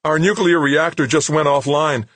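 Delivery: loud, high, alarmed report; high, quavering technician's voice. A narrator's reading. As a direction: report a failure in a rising panic. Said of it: "his voice high and quavering".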